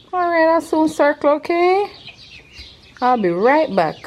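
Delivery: high, sweet voice to a bird; talking to animals. Real, not acted. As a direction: promise the hen sweetly. Talking to the chicken.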